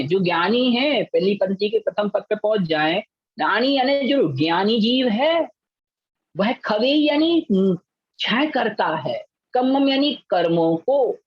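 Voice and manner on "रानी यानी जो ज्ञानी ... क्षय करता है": ordinary delivery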